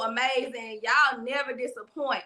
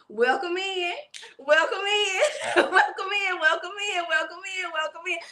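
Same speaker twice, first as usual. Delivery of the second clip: higher, playful chant breaking into laughter; signature welcome-in; 4 semitones higher than usual. Shown as a demonstration. Host doing the welcome for Liz, chanting it playfully. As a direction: repeat the catchphrase, giggling as it rolls on. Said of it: "give y'all the welcome in".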